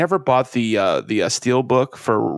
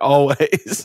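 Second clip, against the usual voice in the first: ordinary talk, nothing marked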